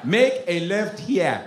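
put-on slow African-accented navigation voice; African GPS. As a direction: give directions slowly in an African accent. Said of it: "I have African G P S".

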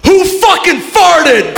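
high, drawn-out comic yell; mad yell. Acted. Man shouting absurdly at a group of people, yelled. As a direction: yell it slowly at a startled crowd. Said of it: "yell something really weird".

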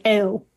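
"oh" with the tongue shifted towards the front of the mouth. Shown as a demonstration. Linguist demonstrating a Durham vowel, fronted "oh". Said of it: "shift our tongue a little bit towards the".

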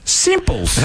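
high squeaky meerkat catchphrase. Retold or imitated. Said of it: "the meerkat says".

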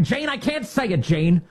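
high, pleading whine; mock whining candidate. Candidate pleading with Jane, high whine. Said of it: "He's like".

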